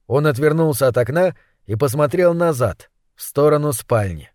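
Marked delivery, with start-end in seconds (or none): none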